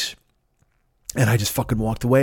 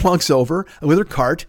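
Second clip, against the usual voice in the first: normal talking voice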